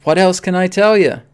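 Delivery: insisting, raise the volume. Real, not acted. The repeat comes out louder.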